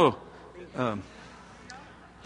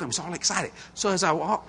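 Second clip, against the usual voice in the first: usual speaking voice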